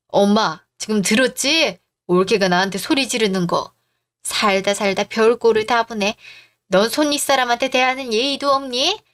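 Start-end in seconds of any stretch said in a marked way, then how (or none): none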